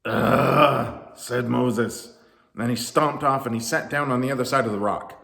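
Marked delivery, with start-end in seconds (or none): 0.0-1.2 loud groan of defeat; exasperated groan. A narrator's reading. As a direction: groan in sheer frustration. Moses gives up, stomps off.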